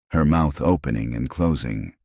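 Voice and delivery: plain reading voice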